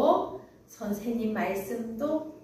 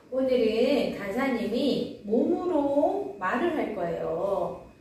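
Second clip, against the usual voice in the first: ordinary delivery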